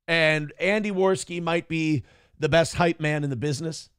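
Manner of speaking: ordinary delivery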